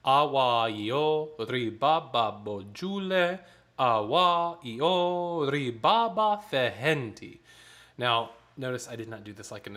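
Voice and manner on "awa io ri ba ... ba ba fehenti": slow rhythmic speaking of the text; spoken in rhythm, slower. Shown as a demonstration. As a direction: chant the syllables slowly with emphasis. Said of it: "I'll do it, um, twice through".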